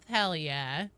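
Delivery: ordinary delivery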